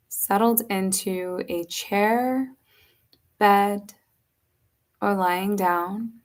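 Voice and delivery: slow, unhurried yoga nidra guiding voice with long pauses; measured, spacious meditation delivery; guided meditation. Acted. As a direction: guide them gently into rest, leaving space between phrases.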